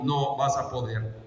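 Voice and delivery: low, soft, falling verdict; dismissive doubt. Acted. King Saul looks at David and doubts him, dismissive. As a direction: write the boy off quietly.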